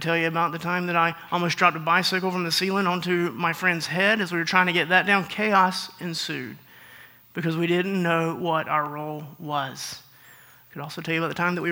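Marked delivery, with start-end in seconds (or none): none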